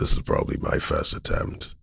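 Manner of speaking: low creaky vocal fry. Shown as a demonstration. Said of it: "consciously attempted a vocal fry".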